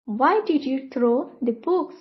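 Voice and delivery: normal talking voice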